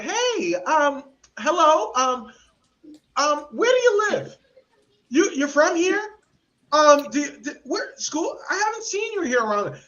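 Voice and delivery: high, halting, nosy questioning voice; suspicious neighbour. Retold or imitated. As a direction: play a wary resident quizzing a stranger.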